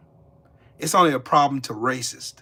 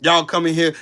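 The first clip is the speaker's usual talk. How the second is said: ordinary talk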